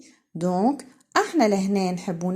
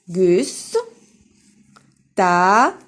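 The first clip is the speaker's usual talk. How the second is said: "Gus. Ta." as isolated beats with long gaps; syllable by syllable; 5 dB louder than usual. Shown as a demonstration. Teacher counting syllables for pupils, "Gus. Ta." said piece by piece. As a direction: break off "Gus", pause, then a loud "Ta". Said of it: "Trois syllabes".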